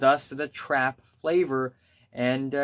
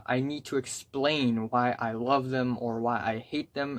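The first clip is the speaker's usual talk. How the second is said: normal talking voice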